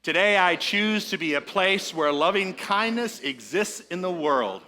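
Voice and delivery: preacher's call and response; leading a group affirmation. Shown as a demonstration. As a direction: say it out for all to echo. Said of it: "Say that with me, please".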